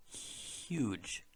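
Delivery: Huge said slowly, softly stretched start; slow demo. Shown as a demonstration. Teacher stretches Huge to show the ich sound. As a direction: draw out the h in Huge. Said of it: "say it very slowly".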